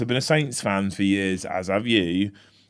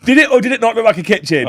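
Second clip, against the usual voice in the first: raised, pressing question with weight on it; teasing demand; 8 semitones higher, 6 dB louder than usual. Real, not acted. Co-host pressing the other to answer, raised and teasing. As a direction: push him for an answer.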